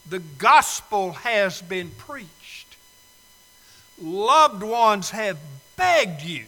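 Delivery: forceful preaching. Acted. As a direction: hammer each phrase home from the pulpit. Preacher building to full force.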